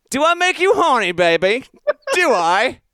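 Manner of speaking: high-pitched, hammed-up British accent. Podcaster blurting out a movie line while on hold, in a put-on British accent. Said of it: "what's something common that's a British accent".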